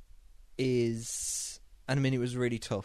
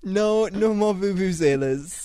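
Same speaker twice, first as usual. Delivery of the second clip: mock decree; 8 semitones higher, 8 dB louder, slower than usual. Mock official ban.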